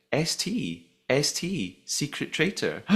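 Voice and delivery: usual speaking voice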